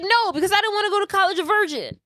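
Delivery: fast, high-pitched indignant imitation; mock protest. Retelling a boyfriend's refusal to his date, mock protest. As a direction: blurt it out as a whiny objection.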